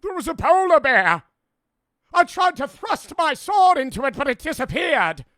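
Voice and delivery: high, loud hysterical guardsman; frantic old-time guard. Acted. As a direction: shout your testimony in a panic. Acts frantic guard answering the examiner.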